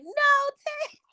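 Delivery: high, shocked cry; surprised. Retold or imitated. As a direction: gasp it out in disbelief. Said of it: "I was like".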